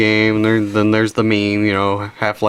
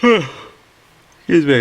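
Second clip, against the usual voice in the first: slow, higher words through a yawn; 5 semitones higher, much slower than usual. Real, not acted. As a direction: let a real yawn stretch the words out. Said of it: "I finally yawned".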